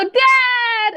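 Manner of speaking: high, drawn-out call; eager call to dad. Acted. Player acts character calling to dad.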